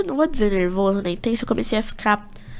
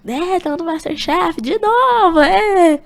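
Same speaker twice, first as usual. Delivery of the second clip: high, loud drawn-out whoop; excited cheer; 8 semitones higher, 6 dB louder, much slower than usual. Retold or imitated. Reliving being on MasterChef once more, excited cheering. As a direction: celebrate with a rising, stretched-out cheer. Retold group celebrating the news.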